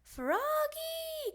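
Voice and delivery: mother calling Froggy from far. Acted. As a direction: call him from afar, sung out. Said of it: "called his mother".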